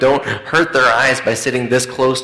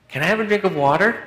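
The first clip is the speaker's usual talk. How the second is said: put-on much higher, insistent child whine with stress on drink; more emphatic plea; 8 semitones higher, faster than usual. Boy asking mom again from bed, more insistent whine. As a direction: push the kid's plea harder, rising. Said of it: "A little more emphatically".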